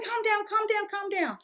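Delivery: high, urgent imitation of mom; mother calming. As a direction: hush her quickly, mom's voice. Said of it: "She's like".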